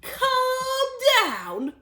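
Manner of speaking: outraged cry. A narrator's reading. Mister Cranky outraged at being told to calm down, loud. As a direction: fling the words back. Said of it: "cried Mister Cranky".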